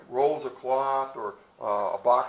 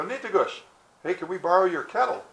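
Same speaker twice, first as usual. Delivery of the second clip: higher, louder friendly hail; calling out a request; 5 semitones higher, 5 dB louder than usual. Acted. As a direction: hail them to ask a favor. Voices women calling to the Frenchmen.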